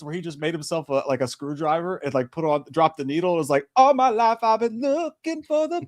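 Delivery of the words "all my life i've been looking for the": high, slow put-on movie voice; hammed-up slow high line; movie villain quote. Impressing a movie scene on his friend, high and slow.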